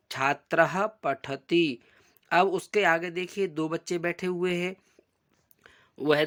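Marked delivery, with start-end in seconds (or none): 0.1-1.8 slow, careful Sanskrit recitation. Shown as a demonstration. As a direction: spell out each syllable slowly for pupils. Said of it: "तो देखो क्या लिखा है". (धीमा संस्कृत पाठ)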